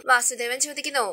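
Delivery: usual speaking voice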